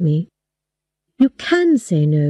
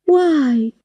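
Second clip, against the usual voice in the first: lightly voiced small child, higher; 7 semitones higher than usual. A narrator's reading. Girl asking her father why, lightly childlike. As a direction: ask it like a little child would. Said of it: "I spoke like a small child".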